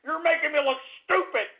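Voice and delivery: high, wounded complaint; hammed-up hurt outrage; indignant rant. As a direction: whine it at the callers. Ranting at prank callers.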